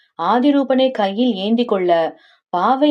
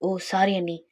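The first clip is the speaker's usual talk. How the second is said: soft, contrite sorry; hushed apology; 7 dB quieter, slower than usual. Acted. Told off for being noisy. (மெல்லிய குரலில் மன்னிப்பு)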